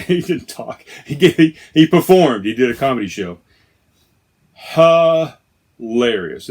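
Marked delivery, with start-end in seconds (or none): 4.5-6.5 loud, very slow HILARIOUS; drawn-out emphasis. Real, not acted. Fan raving about the second comedian, drawn-out emphasis. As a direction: stretch out the first syllable of HILARIOUS.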